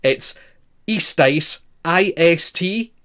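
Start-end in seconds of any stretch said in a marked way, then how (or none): 0.9-1.6 istais said "EE-stice"; careful pronunciation model. Shown as a demonstration. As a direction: istais set apart for rehearsal. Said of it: "make sure you rehearse the pronunciation of this".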